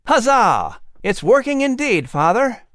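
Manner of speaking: high excited cheer. Acted. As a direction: cheer for your father. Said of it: "William cheered".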